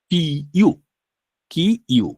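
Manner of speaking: কি ইউ as two full sounds; separate pronunciation. Shown as a demonstration. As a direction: hold কি, then give ইউ its own start. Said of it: "তাহলে হতো যে".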